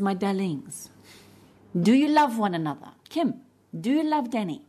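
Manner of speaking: a hint of a French accent; French therapist. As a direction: retell the elderly French therapist's tender question, lightly accented. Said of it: "she's French. She goes".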